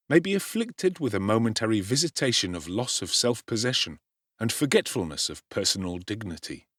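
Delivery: plain reading voice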